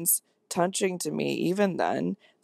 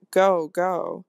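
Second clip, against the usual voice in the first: a hint of frantic urgency; lightly urged, understated haste. A narrator's reading. Governess sending the housekeeper away, mildly frantic. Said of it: "an almost frantic".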